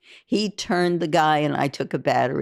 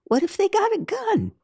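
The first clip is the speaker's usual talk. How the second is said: a sudden high frightened squeak at being sent in first; panicked protest. Retold or imitated. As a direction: voice jumps very high in alarm. Panicked protest at the robbery plan.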